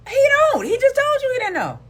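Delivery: exasperated outburst. Real, not acted. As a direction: yell it in disbelief. Exasperated repeat about him.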